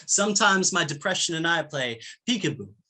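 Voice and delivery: measured spoken-word recitation of a poem. Acted. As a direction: perform the poem to the room, unhurried.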